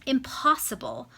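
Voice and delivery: wife rejecting husband's demand, a mild cry; cried out. Acted. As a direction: fling the word out in protest. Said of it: "cried Lady Cecilia".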